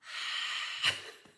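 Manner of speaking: big weary exhale; long exasperated sigh. Real, not acted. Winding down after ranting about dad, heavy sigh. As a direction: let out a long, heavy breath.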